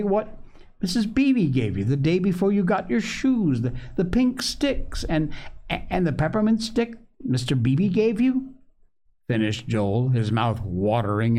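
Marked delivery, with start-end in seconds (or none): 0.0-8.5 high, excited reminding; crying out. A narrator's reading. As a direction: tumble eagerly through the memory. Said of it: "cried Polly".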